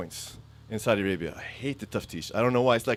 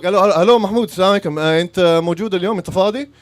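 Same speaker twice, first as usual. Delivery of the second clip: loud phone call; 6 semitones higher, 11 dB louder, much faster than usual. Retold or imitated. Calling the driver on phone.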